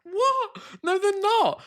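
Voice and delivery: high, sharp what of disbelief; indignant disbelief. Real, not acted. Host objecting to the guest, high and indignant. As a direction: cut in, scandalised.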